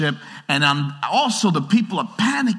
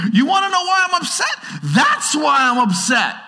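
mock indignant; 11 semitones higher, 3 dB louder than usual. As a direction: dare them, then point at the window. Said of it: "they look out their window".

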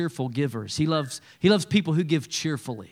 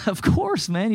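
much higher, laughing delight; cheerful laugh; 6 semitones higher than usual. Pretend. Cheerful giver answering a friend's need, laughing. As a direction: squeal it out with a laugh of joy. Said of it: "You, like, start laughing".

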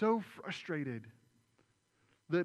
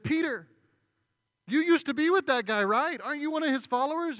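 much higher, louder, pushy questioning; accusing bystander; 11 semitones higher, 11 dB louder than usual. Retold or imitated. Bystander in the crowd accusing Peter, high and loud. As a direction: corner him with the question.

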